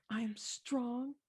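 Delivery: put-on high, slow, soft toddler voice; little girl. Little girl repeating after her mother, childlike. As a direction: imitate a small child echoing bravely. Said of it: "the little girl says".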